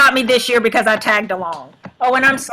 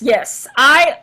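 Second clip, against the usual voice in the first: normal talking voice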